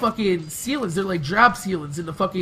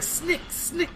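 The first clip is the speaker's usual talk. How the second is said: imitated blade snick; 7 semitones higher, 7 dB quieter than usual. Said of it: "and he was like".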